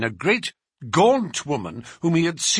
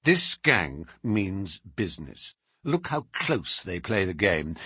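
lower, grave explanation; severe; 6 semitones lower than usual. A narrator's reading. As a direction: turn serious and lay out the danger. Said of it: "turning suddenly from lively to severe".

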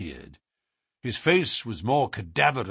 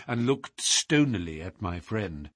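plain reading voice

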